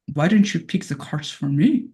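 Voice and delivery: low-pitched, put-on British accent; Crowley. Playing Crowley asked to pick cards, in a low British accent. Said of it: "British, British accent, darling".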